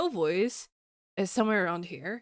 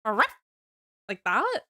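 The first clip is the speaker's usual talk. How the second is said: short playful puppy bark; mock puppy yip. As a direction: try out a little questioning woof. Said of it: "We're getting the puppy voice?".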